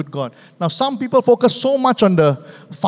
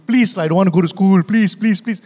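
imitating a child begging; 2 semitones higher, 4 dB louder than usual. Pastor imitating his young son begging to skip school. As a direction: plead like a kid who won't go to school. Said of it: "my seven-year-old Skylar every Monday morning".

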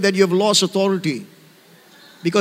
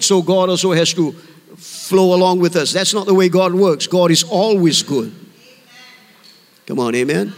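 ordinary talk

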